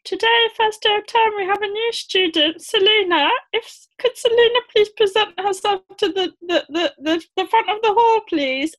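high, formal school assembly announcement; a headteacher addressing the hall; teacher announcing. Retold or imitated. Teacher announcing new pupil.

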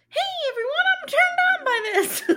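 high, loud and laughing, a mock turned-on Manly; hammed-up Manly. The host mocks Manly enjoying his wife's temper, squeaky high laughing voice. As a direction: squeak it out like a giddy aroused husband, laughing. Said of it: "Manly was, like, slightly like".

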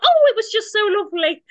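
gushing Sheila. Retold or imitated. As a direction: ham up fake sweetness. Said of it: "was like".

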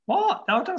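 high, sharp shocked what. Real, not acted. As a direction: react in sudden disbelief. Shocked to hear Bob died.

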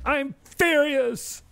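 very high, slow squeaky echo; mock furious squeal. Copying the furious drop from the soundboard. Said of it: "repeating the drop".

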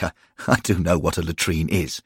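ordinary delivery

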